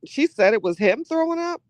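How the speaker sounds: ordinary talk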